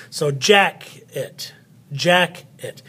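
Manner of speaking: slow "jacket", JACK strong, then a separate light "et"; stress on first syllable. Shown as a demonstration. Teacher showing where the accent goes, "jacket" split with JACK stressed. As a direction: hit JACK in "jacket", pause, then drop on "et". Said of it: "the accent goes on the first part of the word".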